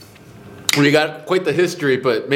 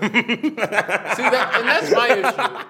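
higher-pitched, talking through laughter; laughing; 8 semitones higher, much slower than usual. Real, not acted. Host reacting to the rejected dollar donations, laughing. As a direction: make the point while laughing.

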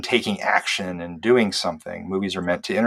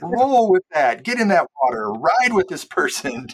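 urging listener; 9 semitones higher, 4 dB louder than usual. Retold or imitated. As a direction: plead with them to stay in the moment. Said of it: "I'm always like".

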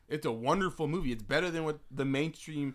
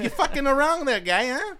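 high, loud Boston guy voice; pitched-up Boston accent, teasing; 12 semitones higher, 9 dB louder than usual. Pretend. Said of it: "with a Boston accent".

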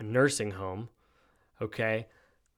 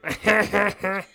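high cackling laugh; mock high-pitched giggle; Kamala Harris laugh; 9 semitones higher, 5 dB louder than usual. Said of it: "such a Kamala Harris laugh".

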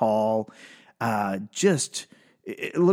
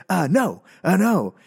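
nervous refusal; 6 semitones higher, 4 dB louder than usual. Retold or imitated. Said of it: "It was like".

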